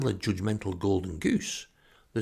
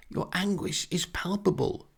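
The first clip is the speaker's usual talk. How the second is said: much higher, breathless admiration; gasped awe; 10 semitones higher than usual. Retold or imitated. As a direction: gush over the performance. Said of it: "She gasps and just says".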